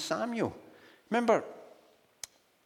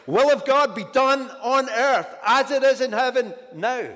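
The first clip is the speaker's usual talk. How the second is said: loud, high, proclaimed command; commanding declaration; 9 semitones higher, 9 dB louder than usual. Shown as a demonstration. Preacher showing the right way, loud declaration. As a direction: call it forth with authority. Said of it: "is the way we're supposed to pray this".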